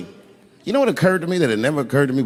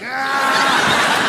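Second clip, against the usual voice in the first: battle cry; 9 semitones higher than usual. Retold or imitated. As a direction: yell charging in. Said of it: "He was like".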